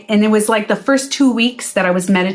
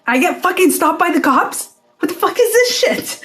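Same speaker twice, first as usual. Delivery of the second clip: high-pitched, incredulous complaint; mock outrage; 7 semitones higher than usual. Storyteller stopped by cops, high mock outrage. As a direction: climb into a squeaky, laughing protest.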